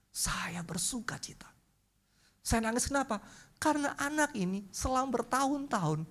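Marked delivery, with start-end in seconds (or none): 0.1-1.5 whispered, soft and slow; hushed whisper. Real, not acted. Preacher tells the congregation of his joy, whispering. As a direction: breathe it out in quiet joy.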